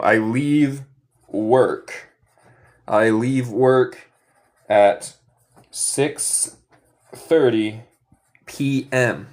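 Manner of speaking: slow, clear modeling; slow model sentence. Shown as a demonstration. Teacher modeling the sentence for students, slow. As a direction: drag each word out for the class. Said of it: "¿Qué significa leave".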